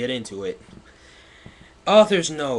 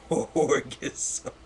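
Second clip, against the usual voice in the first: laughing reread; 3 semitones higher than usual. Shown as a demonstration.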